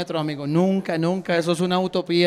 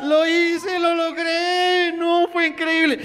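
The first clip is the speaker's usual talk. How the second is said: very high, drawn-out exultant cry; ecstatic disbelief; 11 semitones higher, slower than usual. Acted. Comedian celebrates dancing reggaeton in Medellín, ecstatic. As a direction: squeal slowly with amazed triumph.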